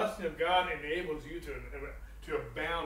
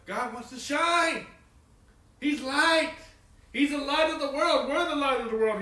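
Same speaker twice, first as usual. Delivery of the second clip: louder, higher sermon proclamation; rising pulpit call; preaching with force; 8 semitones higher, 8 dB louder than usual. Acted. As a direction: proclaim it to the room.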